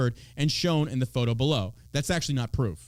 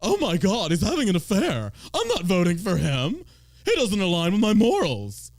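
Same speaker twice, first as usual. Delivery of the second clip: higher, louder, slow scandalized gasping; mock pearl clutcher; 4 semitones higher, 4 dB louder, slower than usual. Mocking shocked voters who clutch pearls, hammed-up horror. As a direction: ham up a shocked churchgoer. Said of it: "to clutch your pearls".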